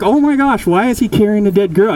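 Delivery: high, startled gasp of alarm; shocked boys. Acted. Boys spotting a dead girl, shocked and high.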